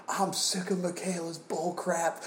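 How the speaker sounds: high put-on grumpy grandparent; mock-grumble like an old grandparent; grandparent impression. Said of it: "your grandparents were just like".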